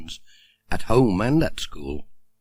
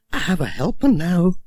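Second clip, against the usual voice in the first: higher, pleased postmistress voice; a proud little announcement, pitched up; 8 semitones higher than usual. Acted. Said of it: "she said".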